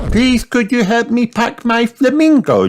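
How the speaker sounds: put-on very high child's plea; high, pleading little-girl voice; little Peppa. A narrator's reading. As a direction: ask daddy in a piglet squeak. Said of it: "asked Peppa".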